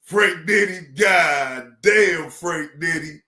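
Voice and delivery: ordinary delivery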